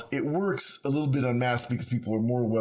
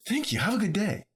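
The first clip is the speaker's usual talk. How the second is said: higher, sing-song imitation of a polite clerk; mock cheery cashier; 9 semitones higher than usual. Retelling the cashier's goodbye, sing-song and higher. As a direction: lightly mimic a sweet shop lady's goodbye.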